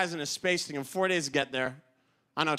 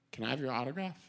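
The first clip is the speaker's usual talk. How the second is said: timid request; 4 semitones lower, 8 dB quieter, faster than usual. Retold or imitated. Timid fan asking for autograph.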